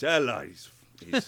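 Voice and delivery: loud, raspy angry growl; a fighter losing his temper; angry Alexios. Shown as a demonstration. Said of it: "when he gets angry".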